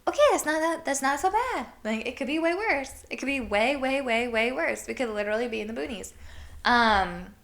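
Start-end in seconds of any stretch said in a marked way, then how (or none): 0.1-6.1 relieved self-talk. Retold or imitated. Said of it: "And it's like".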